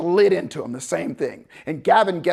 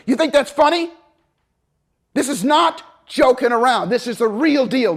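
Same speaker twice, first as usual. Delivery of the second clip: higher, louder stern scolding; angry drill sergeant; 6 semitones higher, 6 dB louder than usual. Retold or imitated. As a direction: dress him down hard.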